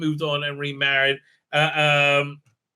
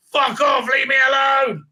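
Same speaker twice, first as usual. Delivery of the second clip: put-on higher, louder furious teenage snap; stroppy teen yell; 8 semitones higher, 6 dB louder than usual. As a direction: yell it like a fed-up teenager telling her dad to back off. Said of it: "She's like".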